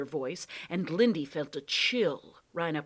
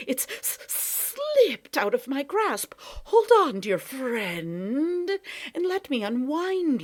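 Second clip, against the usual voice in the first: high, slow fluttery cat voice; a cat pretending to be sorry; flustered cat; 9 semitones higher, 6 dB louder, slower than usual. Sly cat fussing over tangled Lindy, mock alarm.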